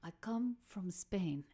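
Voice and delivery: slow, badly imitated Spanish accent; terrible Spanish accent. Fan tells the musician his homeland, slow bad Spanish accent. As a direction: spell it out slowly in a fake Spanish accent. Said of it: "a very terrible Spanish accent".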